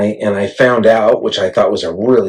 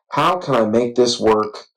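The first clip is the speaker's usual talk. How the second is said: usual speaking voice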